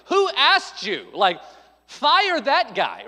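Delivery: play-acted outrage at the organizers; mock outrage. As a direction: cry out in hammed-up disbelief. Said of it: "And I'm like".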